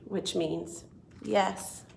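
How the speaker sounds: ordinary delivery, nothing marked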